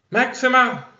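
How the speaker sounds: high, loud summoning call. Acted. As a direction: call the horse over from afar. Character calls her skeleton horse.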